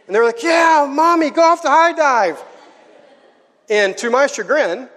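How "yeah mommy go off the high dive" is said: high, cheering mock-child voice; imitating excited kids. Kids cheer Mommy toward the high dive, excited. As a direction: egg Mommy on like thrilled kids.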